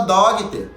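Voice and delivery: "dogter" said DOG-ee-ter with a hard G; "dogter" as a wrong, spelled-out daughter; mispronounced by spelling. Shown as a demonstration. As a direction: read "dogter" Brazilian-style, adding a little i after the G. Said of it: "você não vai falar dogter".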